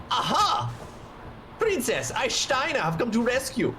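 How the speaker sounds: put-on high, pompous German-accented knight; Steiner. Knight announcing his rescue to the princess, pompous German accent. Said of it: "Steiner is just such a".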